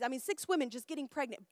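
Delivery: ordinary delivery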